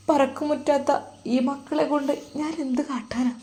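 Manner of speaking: tearful, breaking, half-whispered plea; crying. Acted. Wife to her husband's student, fearing for her sick husband, crying softly. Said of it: "ആ ഉമ്മ കരയുവാൻ തുടങ്ങി".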